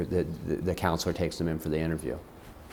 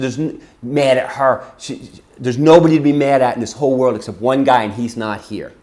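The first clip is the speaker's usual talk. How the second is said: put-on loud, emphatic defence of the child; furious; 5 semitones higher, 12 dB louder than usual. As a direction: re-enact a heated scolding. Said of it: "I'm so furious".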